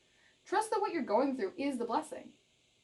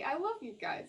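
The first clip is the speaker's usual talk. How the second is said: normal talking voice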